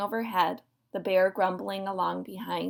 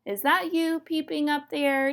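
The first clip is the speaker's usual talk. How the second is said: higher-pitched demanding question; mildly insistent, raised-pitch question; 7 semitones higher than usual. A narrator's reading. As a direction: call it up as a pointed demand. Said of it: "demanded Possum".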